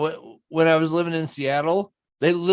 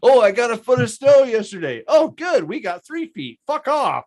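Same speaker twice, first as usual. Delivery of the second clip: mocking brag; 7 semitones higher, 4 dB louder than usual. Mocking snow complaints.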